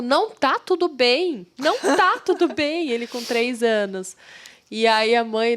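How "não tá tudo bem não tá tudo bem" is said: put-on a child's cross protest, higher and slow; angry child. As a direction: stamp it out like a cross three-year-old. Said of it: "E ele falou bravo".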